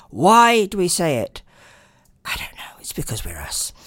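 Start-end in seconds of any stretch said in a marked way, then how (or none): 2.2-3.7 unvoiced whisper, much softer; a soft whispered throwaway aside. Real, not acted. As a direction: whisper it like a secret shrug. Aside after rhetorical question.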